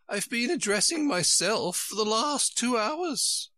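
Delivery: put-on high, fluttery old queen voice; a high, flustered royal voice. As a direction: play the dithering queen, high and fussy. Queen's voice held by reader.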